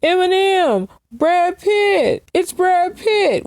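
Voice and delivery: hammed-up fan screaming at a celebrity; starstruck fans. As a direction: squeal the names like a gushing fan. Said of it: "people be like".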